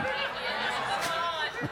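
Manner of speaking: breathy high laugh at his own joke. Real, not acted. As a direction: burst into a high giggle.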